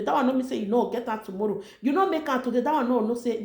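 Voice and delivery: ordinary talk, nothing marked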